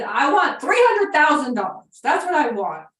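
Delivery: high shouted yeah down the phone; deaf old man screaming. Retold or imitated. Deaf old owner answering his phone, shouting. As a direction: yell it like a deaf old man who can't hear himself. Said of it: "He was screaming".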